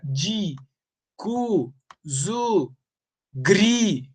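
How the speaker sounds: "di, cu, zu, gri" each said alone with a pause; detached, evenly spaced "di, cu, zu, gri"; isolated stressed syllables. Shown as a demonstration. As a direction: give each of "di, cu, zu, gri" its own arched tune. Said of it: "Eu paro sempre na última sílaba tônica".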